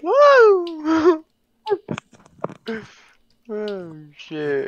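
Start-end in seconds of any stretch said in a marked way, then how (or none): 0.0-1.2 real high-pitched "woo"; sudden whoop, pitched way up. Real, not acted. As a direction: let out a high, carrying whoop. Whoops during game play.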